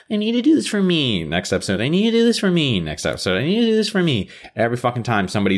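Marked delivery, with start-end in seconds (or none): 0.1-1.2 high-pitched mock plea; mocking imitation. Mocking a character's repeated line. 1.8-2.8 quick, high-pitched mock plea; mocking imitation. Mocking a character's repeated line. 3.4-4.3 high-pitched mock plea; mocking imitation. Mocking a character's repeated line.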